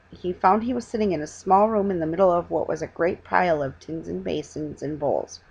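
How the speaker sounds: plain reading voice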